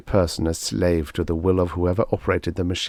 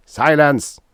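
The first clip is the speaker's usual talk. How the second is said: loud, high barked order to silence him; shouted command; 8 semitones higher, 7 dB louder than usual. A narrator's reading. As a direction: snap it out like a furious commander. Alien leader yells at prisoner.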